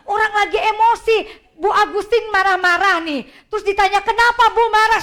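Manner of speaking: loud, high mock anger at being questioned; mock angry. Shown as a demonstration. Teacher acting out an upset person being asked why, loud and high. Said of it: "saya masih emosi gitu ya".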